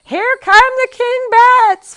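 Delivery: very high, loud drawn-out shriek; mother's scream. A narrator's reading. Mother warns of bats, high piercing scream. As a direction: scream the alarm high and slow. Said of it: "heard his mother scream".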